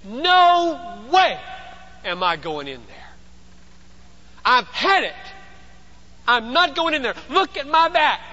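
loud, high-pitched indignant refusal; worn-out body protesting at full voice; body's protest. Acted. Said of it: "And his body says".

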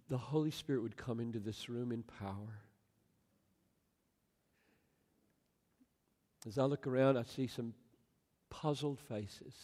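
soft, halting pastoral prayer; public prayer. Real, not acted. Pastor praying for puzzled listeners, soft prayer. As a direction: pray quietly, leaving long pauses between thoughts. Prays aloud before congregation.

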